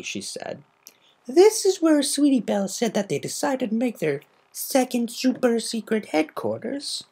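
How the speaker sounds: a polite lady explaining gently; Rarity voice. Acted.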